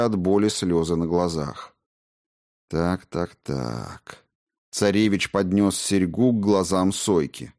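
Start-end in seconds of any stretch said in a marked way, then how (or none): 2.7-4.2 fading to a low, quiet menace; ominous drawl. Acted. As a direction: let the words sink into a threatening hush. Prince menaces captured Soyka.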